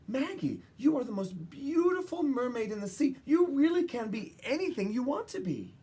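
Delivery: high, bright maternal praise; doting mother. Acted. Maggie recalling her mother's praise, high and gushing. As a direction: coo like a loving mother. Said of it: "My mother's always remarked".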